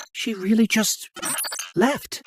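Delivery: hushed, stunned realisation; quiet disbelief. Acted. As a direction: trail through it softly, as if abandoned. Abandoned mid-crisis, in disbelief.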